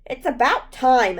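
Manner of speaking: loud, sharp and fed up; angry shout. A narrator's reading. Brooke shouting up at the man pulling her onstage, angry. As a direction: shout it at him, annoyed at the wait. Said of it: "I shouted angrily".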